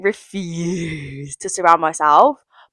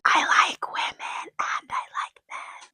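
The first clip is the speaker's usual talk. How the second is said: playful whisper; hushed confession; 10 dB quieter than usual. Real, not acted. Vlogger confessing to viewers she likes women and men, whispered. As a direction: drop into a near-voiceless whisper.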